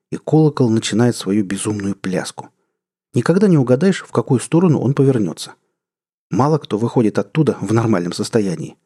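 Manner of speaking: plain reading voice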